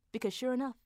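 usual speaking voice

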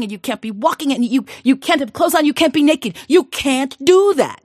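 high, loud, rushing worry; panicked father. Acted. Father frantically telling his daughter the king's terms, loud and high. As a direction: pile up the impossible demands in a panic.

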